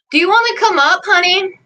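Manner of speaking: high, sweet invitation to the dog; sweet pet talk. Real, not acted. Owner sweetly inviting her dog up, high pet voice. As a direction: coax her up gently.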